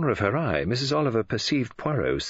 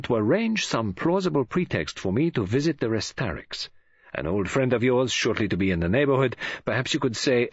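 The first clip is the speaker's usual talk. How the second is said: measured, authoritative detective; Poirot; 4 semitones higher than usual. Acted. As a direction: give instructions with precise formality. Poirot instructs Missus Oliver.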